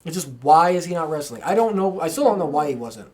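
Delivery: usual speaking voice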